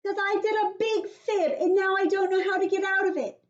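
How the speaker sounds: put-on high, loud little girl yelling for help; Junie B. yelling. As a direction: cry for help like a panicked kid. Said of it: "I shouted some more".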